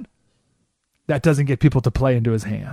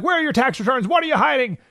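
imitating Hillary; 9 semitones higher than usual. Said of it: "So Hillary could be like".

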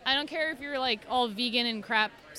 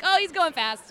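amused cooing; 5 semitones higher, 5 dB louder than usual. Retold or imitated. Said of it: "I'm like".

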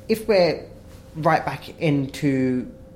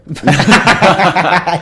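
loud laugh; 4 semitones higher, 12 dB louder than usual. Real, not acted. Bursts out laughing at own joke.

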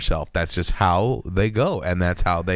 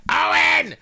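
shouting a name. Retold or imitated. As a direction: yell the cat's name outside. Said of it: "so I'm like".

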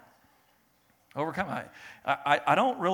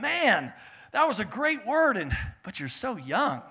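gushing guest; 7 semitones higher, 4 dB louder, slower than usual. Retold or imitated. First-time guest praising the young preacher, gushing. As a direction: imitate an impressed guest, high and drawn out.

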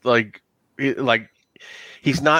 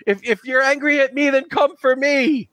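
desperate NPC; 11 semitones higher than usual. Acted. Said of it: "He goes".

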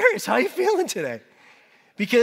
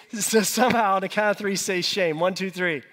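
ordinary talk

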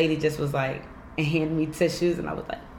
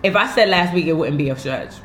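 ordinary talk

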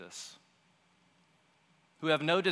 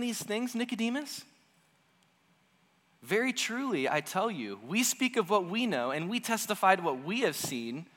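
heated Jesus; 7 semitones higher than usual. Retold or imitated. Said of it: "he's like".

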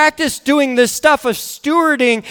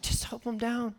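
very soft imitation of wife; hushed urging; 19 dB quieter than usual. Wife asking dad to help the kids, hushed. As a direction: plead under your breath. Wife quietly intervenes in story.

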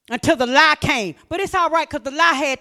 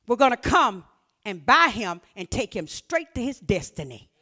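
ordinary talk

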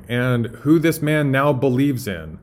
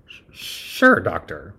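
Igor; 4 semitones lower, slower than usual. Acted. Assistant humors the raving doctor, stammering doubtfully. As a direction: stutter a wary, doubtful agreement. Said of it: "imagine Doctor Frankenstein and Igor".

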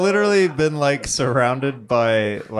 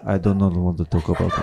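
put-on low, mournful Russian accent; a sad Russian mumble, much lower; mock Russian accent, low and gloomy; 9 semitones lower, faster than usual. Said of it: "it's layered in the accent".

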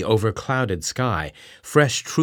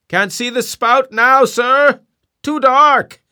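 high, loud shout from the masthead; distant cry; 9 dB louder, slower than usual. Acted. Lookout calls down to the captain, loud cry. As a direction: call down from far above. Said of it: "cried a voice from the air".

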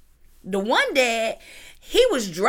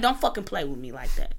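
low angry warning; 9 semitones lower, 4 dB quieter than usual. Retold or imitated. Father warns the family not to play with him, low and menacing. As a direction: drop low and menacing on don't. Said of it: "He was like".

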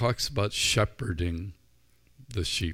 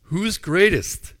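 Who's greatest louder, higher and slow, greatest stretched; incredulous; 10 semitones higher, 9 dB louder, slower than usual. Acted. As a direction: repeat their answer in loud disbelief. Jesus repeats in disbelief.

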